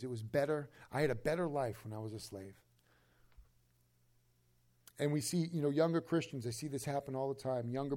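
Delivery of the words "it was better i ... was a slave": low, soft, longing voice, a touch put-on; wistful slave quote. Preacher voicing Israelites missing slavery, soft and low. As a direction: sigh it out like a homesick former slave.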